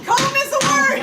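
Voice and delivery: high delighted exclamation; a high excited cry of discovery. Real, not acted. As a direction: celebrate finding the word.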